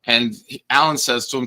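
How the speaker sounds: ordinary talk, nothing marked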